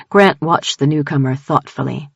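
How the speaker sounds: plain reading voice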